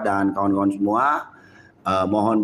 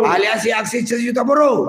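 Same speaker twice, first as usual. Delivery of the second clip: full-throated chant, very high; fiery revolutionary speech; 13 semitones higher, 3 dB louder than usual. Real, not acted. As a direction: bellow the alliance name to the crowd. Leading rally chant.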